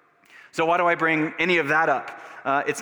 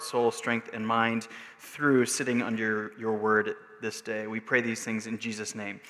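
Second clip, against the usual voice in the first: congregational prayer; 2 semitones lower than usual. Real, not acted.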